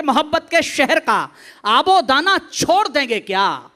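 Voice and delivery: loud declaimed verse; rousing stage poet; forceful poetry recitation. Acted. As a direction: belt the couplet out to the back rows.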